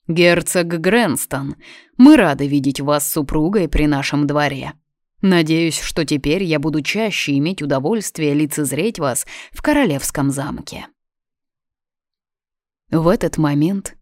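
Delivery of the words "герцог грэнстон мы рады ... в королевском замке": measured king, stiffly polite; ceremonious restraint. Acted. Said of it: "ответил церемонно и подчёркнуто сдержанно". (церемонно и подчёркнуто сдержанно)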